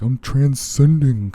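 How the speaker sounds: slow, loud hippy-dippy mockery. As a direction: ham up the spiritual guru. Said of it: "like, some, like".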